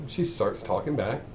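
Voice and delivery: normal talking voice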